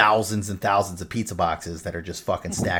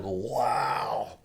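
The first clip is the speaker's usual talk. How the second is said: awed wow; 9 dB quieter than usual. Retold or imitated. Retelling own awed reaction.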